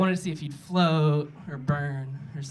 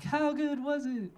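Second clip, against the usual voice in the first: demonstrated crowd response; 7 semitones higher, 3 dB quieter than usual. Shown as a demonstration. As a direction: model a yell-back in a high, held-back voice. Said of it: "I want you to yell back".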